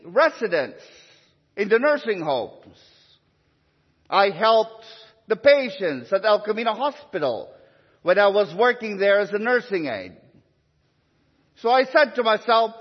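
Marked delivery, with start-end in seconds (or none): none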